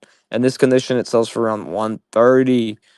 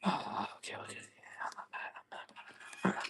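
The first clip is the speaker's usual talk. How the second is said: whisper; 24 dB quieter than usual. Real, not acted. Tense game, quietly muttering to himself.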